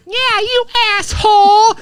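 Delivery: high-pitched mock jeer of a mother; mom heckling from afar. Son imitating his mom heckling the neighbor from twenty yards. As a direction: jeer it high like a mom calling across the yard. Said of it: "and be like".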